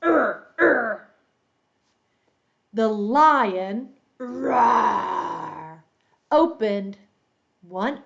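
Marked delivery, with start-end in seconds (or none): 0.0-1.0 repeated grumpy growls; very grumpy lion. A narrator's reading. Storyteller plays the very grumpy lion, repeated growls. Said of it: "Very grumpy". 4.2-5.8 big rough lion's roar. Pretend. Teacher shows children the story cue, big lion roar. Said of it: "when you hear lion".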